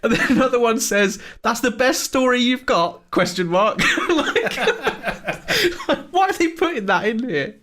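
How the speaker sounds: cracking up mid-sentence; laughing. Real, not acted. Laughing at absurd quotes.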